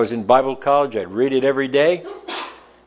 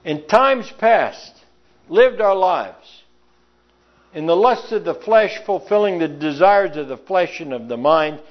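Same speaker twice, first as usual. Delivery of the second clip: raised, fervent preaching with slowed emphasis; 6 semitones higher than usual. Acted. Preacher reminding the congregation of their past, fervently pitched up. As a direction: lift it up and press each phrase home.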